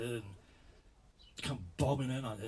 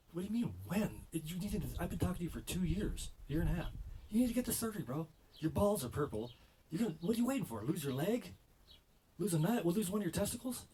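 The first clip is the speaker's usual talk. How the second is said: much higher, louder nagging doctor; incredulous doc voice, high and loud; exasperated doctor; 9 semitones higher, 4 dB louder than usual. Retold or imitated. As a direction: play the fed-up doctor scolding. Doctor scolding him in story.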